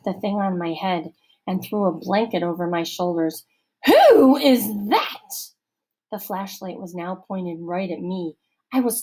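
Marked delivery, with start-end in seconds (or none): 3.9-5.5 slow, loud, higher stern matron; stern camp counselor. A narrator's reading. Missus Periwinkle interrogating Allison, stern, slow and loud. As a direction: lecture slowly like a suspicious matron.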